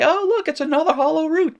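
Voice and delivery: high, loud mock delight; a student fooled into thinking it is easy; mock surprised student. Teacher playing a fooled student, high and surprised. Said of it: "you're gonna say".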